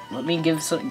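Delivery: ordinary delivery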